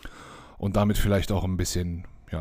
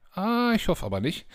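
high-pitched hopeful; 12 semitones higher than usual. Real, not acted. Hopes season not over.

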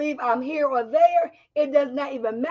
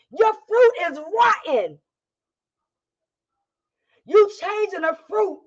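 preaching; 4 semitones higher than usual. Acted. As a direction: cry it out with fire. Preaching at full force.

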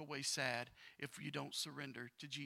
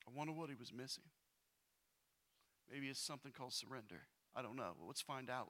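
normal talking voice

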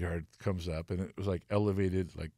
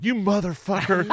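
laughing curse; 11 semitones higher, 5 dB louder than usual. Retold or imitated. Retells own reaction, laughing.

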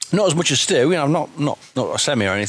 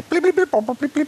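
rapid little high blips; high-pitched blipping imitation; 12 semitones higher than usual. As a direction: mimic a cartoon character's blippy babble. Imitates a cartoon character's babble.

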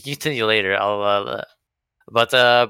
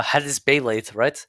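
ordinary talk

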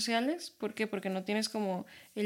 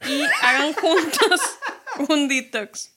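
laughing; 4 semitones higher, 7 dB louder, slower than usual. Real, not acted. Laughs mid-advice.

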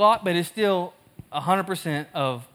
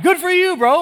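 mock cheer; 10 semitones higher, 5 dB louder than usual. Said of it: "I wasn't like".

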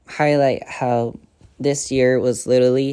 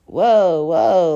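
loud, higher startled whoa, whoa; surprised loud whoa to a crying date; 5 semitones higher, 7 dB louder than usual. Retold or imitated. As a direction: pull him up short, alarmed. Calming him as he cries.

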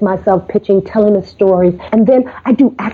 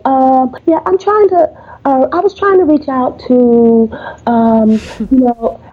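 hesitant, higher, faltering voice; wimpy and unsure; 5 semitones higher than usual. Retold or imitated. Pitching caller sounding wimpy and unsure. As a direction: sound timid and unsure of yourself. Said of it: "I sound wimpy and unsure of myself".

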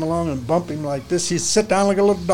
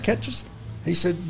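normal talking voice

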